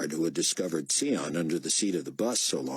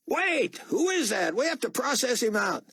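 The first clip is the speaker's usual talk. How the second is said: calling out. A narrator's reading. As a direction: shout after Buck. Guards call after.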